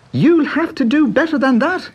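high, mocking boyish laugh; Pat laughing. A narrator's reading. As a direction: taunt him with a laugh. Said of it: "he laughed".

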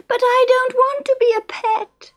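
put-on high wailing little girl voice; wailing mermaid girl. A narrator's reading. Little mermaid wailing at Fred and Isa, very high. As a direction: wail it like a spoilt small child. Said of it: "she wailed".

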